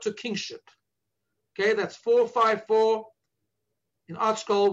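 normal talking voice

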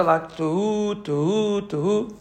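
soft, slow drawn-out tuhu tuhu tuhu; three slow, drawn-out shofar notes; shvarim blasts. Shown as a demonstration. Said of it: "sort of broken sounds but a bit longer".

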